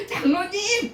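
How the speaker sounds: high-pitched mock shout; mild yell, lifted in pitch; retold shout. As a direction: imitate calling out for the elder. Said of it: "뭐 이렇게 소리 지르면".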